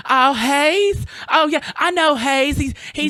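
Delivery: high, loud Southern drawl imitation; imitating his Southern character; feminine Southern man. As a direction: camp up a Southern church gent. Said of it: "that's my feminine Southern man accent".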